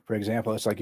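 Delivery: ordinary talk, nothing marked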